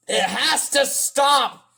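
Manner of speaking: high, slow, emphatic demand; shouting like another YouTuber. Retold or imitated. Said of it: "I'm sounding like another guy".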